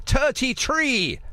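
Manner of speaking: slightly put-on, drawn-out bad Irish accent; terrible Irish accent. Host wishing Donna happy birthday, badly faked Irish accent. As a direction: have a slow, clumsy go at Irish. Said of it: "terrible Irish accent".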